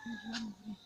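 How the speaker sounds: very soft, subdued confession; hushed confession. Real, not acted. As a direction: confess quietly, as one about to die. Confessing before death, asking pardon. (confession à voix très basse)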